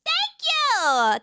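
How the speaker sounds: high, drawn-out shout of thanks; stretched, high-pitched streamer hype; excited thank-you. Real, not acted.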